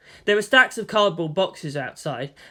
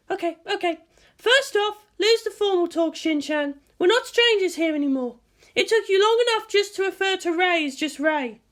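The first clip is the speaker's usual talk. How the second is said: higher, casual woman's voice; teasing guardian; 14 semitones higher than usual. A narrator's reading. Misato teases Shinji, higher and casual. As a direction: lightly tease him.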